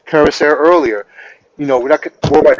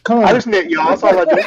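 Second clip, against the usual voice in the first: high, laughing little plea; mock-innocent knitter; 4 semitones higher than usual. Panelist playing the accused knitting sister, mock-innocent plea. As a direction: plead innocence in a raised, playful whine.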